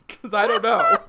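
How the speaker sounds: giggling through the words; laughing. Real, not acted. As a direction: laugh as you say it. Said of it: "laughing through words".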